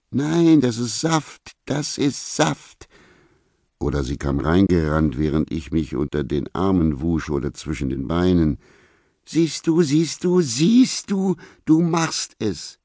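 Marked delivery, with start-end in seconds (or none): 0.1-2.9 very high woman's voice, slowing on the repeat; high, stubborn contradiction; insistent accuser. Acted. Vicky insists in acted quarrel. 9.2-12.8 very high, squealing woman's voice; triumphant accuser. Acted. Vicky catches him, triumphant accusation.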